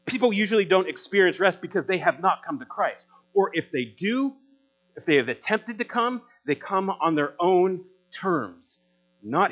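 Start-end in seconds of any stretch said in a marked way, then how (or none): none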